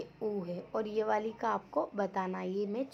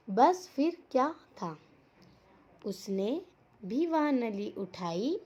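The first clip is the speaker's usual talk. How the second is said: slow, loud, measured storytelling; story read-aloud; 5 dB louder, slower than usual. A narrator's reading. Teacher reading the crow's solution to children, emphatic story read-aloud. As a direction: punch key words, pause after each phrase.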